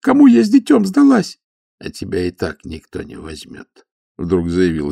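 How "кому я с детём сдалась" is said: put-on much higher girlish voice, begging through tears; girl begging the stoker through tears; tearful pleading. A narrator's reading. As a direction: plead like a desperate young girl. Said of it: "Девушка перестала рыдать".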